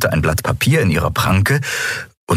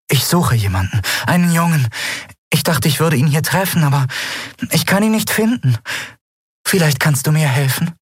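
warm, sweet voice; 10 semitones higher than usual. Acted. Young woman asks Endriel for help, sweet desperate voice. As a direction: plead sweetly and a little lost. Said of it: "so warm und lieblich".

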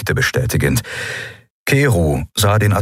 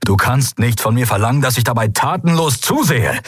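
higher, emphatic and mounting agitation; heated outburst; 7 semitones higher than usual. A narrator's reading. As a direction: build the frustration into a protest. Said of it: "beschämt von seinem Ausbruch". (aufgebrachter, eindringlicher Ausbruch)

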